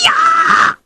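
a sudden, very high yelp of alarm; storyteller's alarmed shriek at the tiger news; shocked cry. Acted. News that a tiger carried him.

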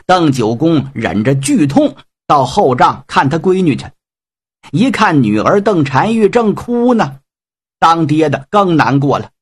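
ordinary delivery

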